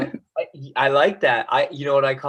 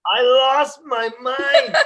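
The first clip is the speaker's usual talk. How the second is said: high, loud, drawn-out exclamation; hammed-up outburst; 11 semitones higher, 6 dB louder, slower than usual. Parent retelling reaction to kid's Fortnite line, hammed-up laughing outburst. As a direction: replay losing it, slow and laughing.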